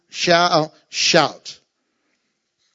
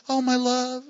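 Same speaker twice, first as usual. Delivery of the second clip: dreamy lover; 4 semitones higher, 5 dB quieter than usual. Pretend. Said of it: "the first thing you think about is".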